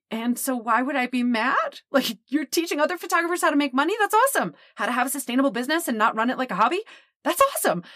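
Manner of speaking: high, rushing praise peaking on awesome; enthusiastic client. Retold or imitated. Client telling her teaching is great, excited and high. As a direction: gush that it's awesome.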